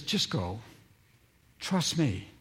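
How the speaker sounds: quietly settling their worries; calm reassurance. Acted. Jesus reassuring his anxious disciples, soft and calm. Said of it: "and Jesus says".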